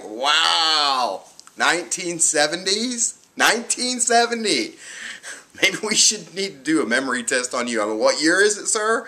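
high, loud sarcastic jab; mock him with exaggerated astonishment; taunting the doctor over an old study; mocking disbelief.

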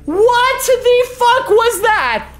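high, loud, startled shriek; scared shriek. Real, not acted. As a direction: shriek in panicked fright. Scared by monster in game.

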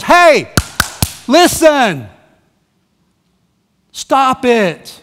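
loud, high shouted commands with pauses; Paul going off. Retold or imitated. Fed-up apostle to arguing church, shouted commands. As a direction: bark each order, imitating someone who has snapped. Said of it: "Paul goes off".

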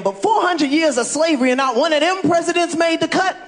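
ordinary delivery

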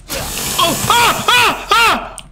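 high sudden yelps of pain; startled yelp. Real, not acted. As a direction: yelp when hit out of nowhere. Hit by enemy in game.

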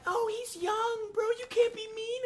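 high, slow whiny mock voice; squeaky mock plea from chat. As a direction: mimic the soft-hearted viewer. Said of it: "don't be like".

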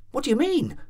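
high, frightened insistence, falling hard. A narrator's reading. Landlady frantically repeating her question to the doctor, frightened.

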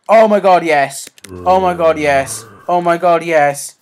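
joyful yelling; elated cheer. Real, not acted. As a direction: celebrate the big find. Celebrating a find.

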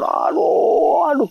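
imitated high-pitched ow; mock yelp of pain, drawn out. Adrian falls after kicking wildly, imitated cry of pain. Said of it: "Terus kesakitan".

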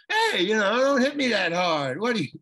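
loud imitating of a peeved co-star; mock angry complaint. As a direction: ham up an offended actor complaining. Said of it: "he got really mad".

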